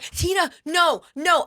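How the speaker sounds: high, pleading mock coworker voice; panicked pleading coworker. Coworker begging Tina not to go outside, high pleading. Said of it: "Hannah's like".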